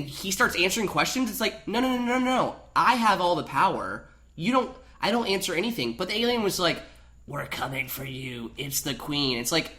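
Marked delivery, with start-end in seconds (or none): 1.7-5.9 high, insistent mock protest; hammed-up refusal, much higher; mock defiance in English; mock defiant prisoner. 7.3-9.4 slow, soft alien menace; hint of a creepy alien, slower and softer; a touch of alien menace; menacing alien voice. Voicing alien threat.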